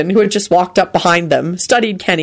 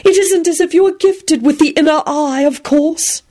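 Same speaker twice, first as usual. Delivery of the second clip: airy, high-pitched condescension; soothing; 10 semitones higher than usual. A narrator's reading. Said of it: "began in a soothing voice".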